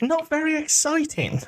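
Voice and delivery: high, slow incredulous echo; mock disbelief. Co-host challenging the verdict on snow, mock-indignant. Echoes co-host's phrase in mock objection.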